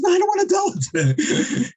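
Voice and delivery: squeaky high-pitched refusal, way up; panicked refusal. Acted. Water carrier refusing the rabbi, in a squeaky high panic. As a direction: yelp it out like a frightened poor man.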